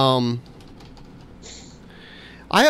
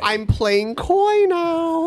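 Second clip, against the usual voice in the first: coy voice; 12 semitones higher, much slower than usual. Pretend. Coy pun voice.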